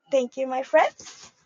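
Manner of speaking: ordinary delivery, nothing marked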